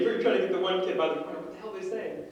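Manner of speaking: normal talking voice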